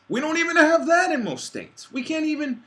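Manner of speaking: high, loud, exasperated shout; outraged shout. Real, not acted. Ranting about missing basic rights, an outraged shout. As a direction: shout it at the injustice.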